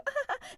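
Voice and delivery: high forced giggle, shown as fake; fake laugh. Shown as a demonstration. Explaining to the host that laughs can't be faked, forced giggle. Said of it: "I couldn't just go".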